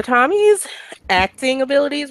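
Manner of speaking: pointedly dubious about the term; air quotes. Real, not acted. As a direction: say it as if in scare quotes. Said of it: "which I put in quotes".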